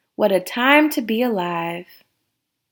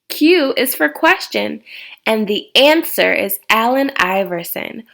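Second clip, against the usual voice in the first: ordinary delivery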